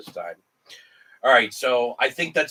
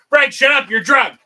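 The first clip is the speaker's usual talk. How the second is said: much higher and louder, exasperated; punchline shout; 10 semitones higher, 11 dB louder than usual. Acted. Second horse to the bartender, loud exasperated shout. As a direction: bark the line at the drunk horse.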